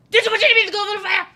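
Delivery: high, very loud frantic shout; Dumbledore yelling. Retold or imitated. Dumbledore losing it and shouting at Harry. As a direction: scream the question at him like a panicked wizard. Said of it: "my favorite Dumbledore quote".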